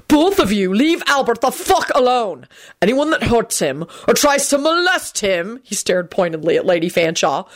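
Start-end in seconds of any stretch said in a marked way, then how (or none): 0.1-5.6 raised pitch, a touch of menace; threatening. A narrator's reading. Quentin warning the elderly Fanshaws off Albert, mildly threatening. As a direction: lay down a firm warning, voice pitched up. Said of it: "gave both the elderly Fanshaws a threatening look".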